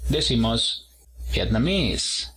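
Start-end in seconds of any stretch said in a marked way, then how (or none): 1.3-2.3 "Vietnamese" as viet-na-MESE; stress on third syllable. Shown as a demonstration. Teacher models the word for vietnamita, "Vietnamese" stressed on third syllable. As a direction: put the weight on the final syllable of "Vietnamese". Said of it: "El acento en la tercera".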